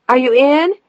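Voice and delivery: high, loud call with a rising end. A narrator's reading. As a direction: shout the question from the back room. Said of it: "she called out to him from the back".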